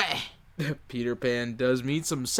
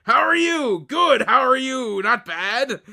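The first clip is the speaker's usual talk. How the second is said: higher, hammed-up pirate small talk; 6 semitones higher, 4 dB louder, slower than usual. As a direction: growl Arr then chirp like a pirate. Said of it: "walk and talk like a pirate".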